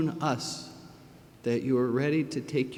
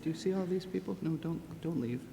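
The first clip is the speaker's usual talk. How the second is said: much softer, tender and close; 12 dB quieter than usual. Real, not acted. Tenderly showing the child the crowd.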